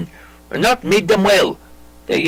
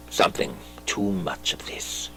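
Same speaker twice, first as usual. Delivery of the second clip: breathy near-whisper, much softer, pulling back; whispered aside; 11 semitones lower, 12 dB quieter than usual. Acted. Hamlet to Horatio, cutting short his praise, whispered. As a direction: hush it, as if embarrassed by his own feeling.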